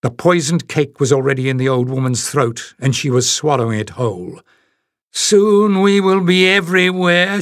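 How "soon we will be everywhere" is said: high, sinister hissed threat; hissing witch. A narrator's reading. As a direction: hiss it like a possessed hag. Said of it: "she hissed".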